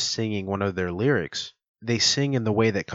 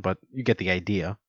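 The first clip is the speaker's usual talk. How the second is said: ordinary delivery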